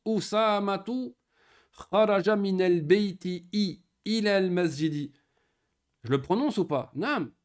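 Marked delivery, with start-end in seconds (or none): 0.0-5.1 Usamatu drawn out slowly and higher, the opening hamza sounded clearly; hamza clearly voiced. Shown as a demonstration. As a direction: stress the hamza at the start of Usamatu. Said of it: "Tu l'entends que je le prononce". (Usamatu lentement, le hamza bien audible)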